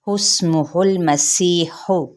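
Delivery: हुस्मुहुल मसीहु recited slowly, joining ha to lam; slow model recitation; tajweed recitation. Shown as a demonstration. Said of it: "हा की पेश पे लाम के साथ मिलाएंगे".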